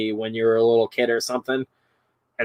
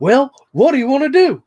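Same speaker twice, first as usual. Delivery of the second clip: much higher, louder toy squeak; creepy toy voice; 13 semitones higher, 6 dB louder than usual. Retold or imitated. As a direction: squeal it like the bootleg bear. Imitating the toy line.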